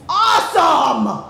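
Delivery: loud, slow, elated exclamation; shout. Retold or imitated. Husband thrilled about the foot rub, shouting loudly. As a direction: burst out with delight on awesome. Husband gushing at cab stop.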